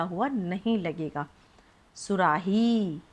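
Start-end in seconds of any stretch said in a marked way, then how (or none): 2.0-3.0 सुराही said slowly with a long "heee"; long final vowel. Shown as a demonstration. Teacher lengthens the last syllable to show pupils, drawn out. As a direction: stretch out the ही at the end of सुराही. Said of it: "आराम से आप लंबा भी बोलेंगे".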